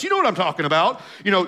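normal talking voice

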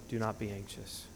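soft, low-volume delivery of the command; quiet, weighty Do not be anxious; hushed command. Shown as a demonstration. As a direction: drop the voice right down and say it gently. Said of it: "It's emphatic".

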